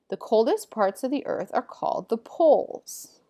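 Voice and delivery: lively, emphatic textbook reading; animated classroom read-aloud with lifted key words; reading aloud to pupils. Acted. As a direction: read the facts out clearly to a class. Reads textbook passage aloud to pupils.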